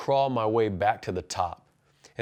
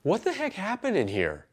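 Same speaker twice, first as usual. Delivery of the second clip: wife's puzzled question; 8 semitones higher, 3 dB quieter than usual. Retold or imitated. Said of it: "she's like".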